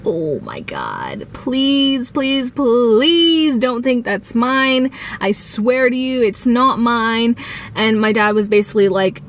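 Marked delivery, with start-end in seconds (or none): 0.0-7.4 begging with long drawn-out pleases; retold pleading. Teen begging parents to believe her, pleading. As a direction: stretch the words out in mock desperation.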